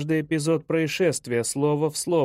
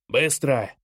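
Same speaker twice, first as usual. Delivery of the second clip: curt bark in a father's voice; barked order; 2 semitones lower than usual. A narrator's reading. Father rushing son out of the house, barking. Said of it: "рявкнул отец".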